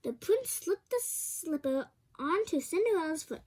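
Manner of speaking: ordinary delivery, nothing marked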